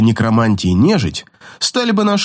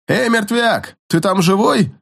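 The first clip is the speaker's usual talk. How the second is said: high, amused mocking call; chuckling mocking call; 8 semitones higher than usual. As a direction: tease him with a chuckle. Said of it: "коротко хохотнул Зартан".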